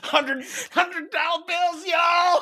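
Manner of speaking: high, drawn-out hype call; mock big-spender cheer. Funeral speaker throwing money at the crowd, mock hype. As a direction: pretend to shower a crowd with cash. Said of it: "pretending to sh-, throw dollar bills out".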